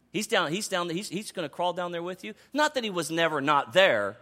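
ordinary delivery

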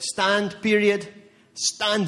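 loud, high punched command; emphatic loud preaching command. Acted. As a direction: hit each word like a command.

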